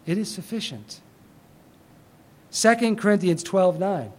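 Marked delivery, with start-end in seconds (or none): 0.1-1.0 hushed repeat. Shown as a demonstration. Quiet repeat of point.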